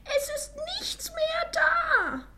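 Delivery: very high, loud, drawn-out cub squeal; squeaky Baby Bear wail. A narrator's reading. Baby Bear finds his bowl empty, squealing wail. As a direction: wail it slowly in a tiny squeaky voice. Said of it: "quiekte Baby Bär mit seinem hohen Stimmchen".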